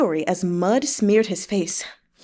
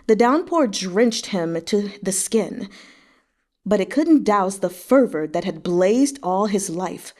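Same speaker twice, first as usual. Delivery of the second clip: ordinary delivery, nothing marked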